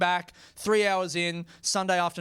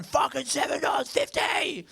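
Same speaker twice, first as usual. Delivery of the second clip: much higher mock yell of outrage; yelling drunk woman; 9 semitones higher than usual. Crazy lady yelling about the wine price, high mock yell. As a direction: spit out the price like it insults her. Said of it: "She's yelling".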